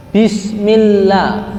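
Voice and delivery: "Bismillah" slow, doubled "ll" held; recited with tasydid. Shown as a demonstration. Teacher showing students the tasydid, slow recitation. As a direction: chant "Bismillah" slowly, leaning on the double l. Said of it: "Di atasnya lam, itu ada tasdid".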